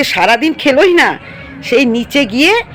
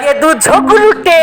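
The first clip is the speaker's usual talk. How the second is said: drawn-out taunt, higher and louder; a slow, loud, high singsong tease at the pet; slow teasing taunt; 8 semitones higher, 5 dB louder than usual. Acted. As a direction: drag out ঝগড়ুটে slowly and loudly to tease the pet.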